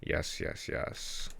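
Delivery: normal talking voice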